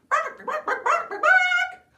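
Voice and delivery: very high, loud clucking bark; be a silly shrieking chicken; squawking chicken. Acted. The chicken makes its noise.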